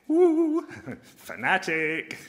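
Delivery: high hooted "ooh", then a laugh; mock alarm. Pastor imitating people's reaction to born-again believers, mock alarm. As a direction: imitate people spooked by a fanatic, playfully. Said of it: "some people will think".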